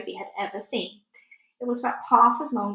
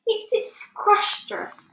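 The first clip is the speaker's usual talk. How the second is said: much higher, slow, gurgling through a full mouth; gurgled; 12 semitones higher, 4 dB louder, much slower than usual. A narrator's reading. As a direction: gargle the word out in disgust.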